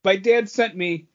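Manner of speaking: normal talking voice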